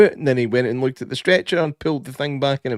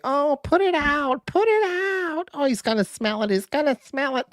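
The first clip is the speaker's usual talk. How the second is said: put-on panicked teen girl; 13 semitones higher, 3 dB quieter than usual. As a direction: squeak out a frantic warning. Teen voiced panicking in story.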